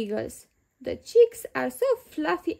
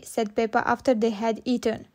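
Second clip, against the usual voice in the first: ordinary delivery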